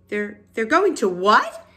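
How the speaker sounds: loud, stammering disbelief rising at the end; screamed question. A narrator's reading. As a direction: burst out in shocked disbelief, short of a full scream. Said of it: "screamed Wilbur".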